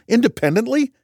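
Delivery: high incredulous scoff. A narrator's reading. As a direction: scoff at the word. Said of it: "I snorted".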